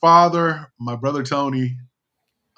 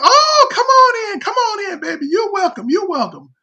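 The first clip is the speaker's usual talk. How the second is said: loud, very high whooped welcome; imitating gushing relatives; 7 dB louder than usual. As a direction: falsetto gush, long drawn "oh" to start. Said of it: "they said".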